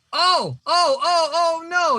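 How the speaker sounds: high, loud "oh no" of surprise; real gasp of disbelief; shocked outcry. Real, not acted. Reacting to discovering the creators.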